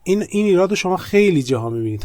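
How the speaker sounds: ordinary talk, nothing marked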